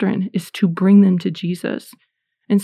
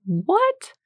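stunned what; 12 semitones higher than usual. Retold or imitated. Shocked by therapist's advice.

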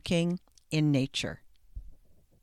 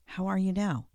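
usual speaking voice

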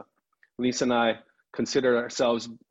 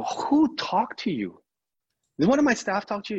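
retold indignant grilling, pitched way up; suspicious demand; 10 semitones higher, 3 dB louder than usual. Asking the consultant who has been talking, suspicious and indignant.

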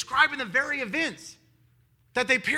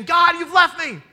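despairing cry; 8 semitones higher, 12 dB louder, slower than usual. Retold or imitated. He's not really saying.

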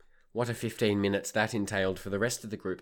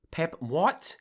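high, louder startled what; shocked; 7 semitones higher, 3 dB louder than usual. Retold or imitated. Hearing a passenger will be pepper sprayed, shocked. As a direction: stumble in disbelief.

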